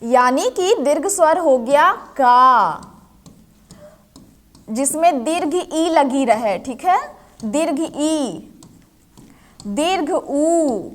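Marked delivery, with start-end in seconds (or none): 1.9-2.8 का with a drawn-out long aa; stretched aa in का; long vowel demo. Shown as a demonstration. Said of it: "दीर्घ स्वर को बोलने में अधिक समय लगता है". 7.4-10.8 slow दीर्घ ई, दीर्घ उ with stretched final vowels; long vowel demo. Shown as a demonstration. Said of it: "दीर्घ स्वर को बोलने में अधिक समय लगता है".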